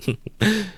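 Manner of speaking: high, bitter laugh; malignant laugh. A narrator's reading. Raskolnikov laughs spitefully to himself, a high bitter laugh. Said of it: "he laughed malignantly".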